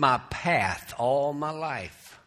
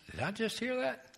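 hushed, higher questioning voice; startled burglar; 9 semitones higher, 10 dB quieter, faster than usual. Acted. Burglar in the dark hears a voice, startled. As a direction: wonder softly to yourself, caught off guard.

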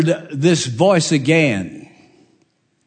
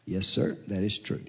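meek quiet admission; 7 semitones lower, 13 dB quieter than usual. Acted. Mary meekly admits under oath.

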